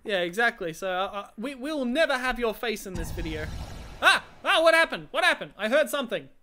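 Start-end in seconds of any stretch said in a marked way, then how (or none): 4.0-5.5 sudden high, loud alarm; startled. Real, not acted. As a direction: jump at the noise and ask. Startled by a sound.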